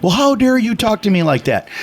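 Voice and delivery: high-pitched affronted retort; mock outrage at normal volume; retold indignant outburst. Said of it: "just going ballistic".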